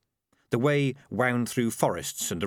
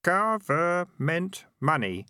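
higher, slow, dutiful repeat of "Government money"; "Government money" recited obediently in a lighter, higher voice; obedient boy; 8 semitones higher, much slower than usual. A narrator's reading. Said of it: "said the boy obediently".

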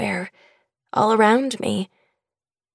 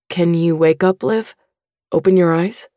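a lower, gentle dad's voice; father asking his unconscious daughter, lower, gentle; fatherly voice; 4 semitones lower than usual. A narrator's reading. Father urges daughter to wake.

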